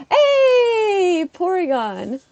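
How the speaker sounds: high, loud delighted shout; excited call. Real, not acted. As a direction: yell it out in glee. Spots Porygon excitedly.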